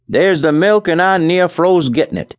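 cold farmhand complaining as he comes in; Charlie's voice. A narrator's reading. Farmhand tells his partner he nearly froze. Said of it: "he said, addressing his partner".

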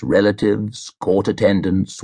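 ordinary delivery

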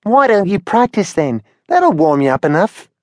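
put-on high young bird, teasing suggestion; Henry advising Billy, in a high bird voice; Henry's voice. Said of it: "said Henry".

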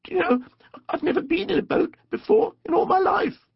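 very high, wondering little voice; delighted Mole. A narrator's reading. Mole tells Rat of his first boat, wondering. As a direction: confide a marvel in a high squeak.

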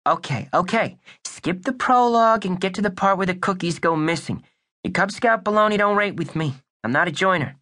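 put-on wisecracking boy detective voice; tough kid detective. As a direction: play it like a pint-sized noir detective.

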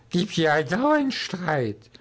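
put-on slow slurred drunk lament; despairing drunken slur. A narrator's reading. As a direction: drag it out like a hopeless drunk. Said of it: "lallte er verzweifelt vor sich hin".

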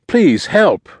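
high, loud plea for help; frantic boy begging; desperate cry. A narrator's reading. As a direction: cry out in a panic. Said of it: "the boy cried out".

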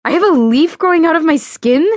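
high, loud disbelieving question; freaked out. A narrator's reading. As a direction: voice climbing in horror. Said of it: "fully freaked out".

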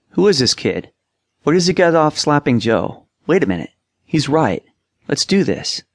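mild indignation turning to eager agreement; lightly acted awestruck teammates. A narrator's reading. Huddle teammates react in awe.

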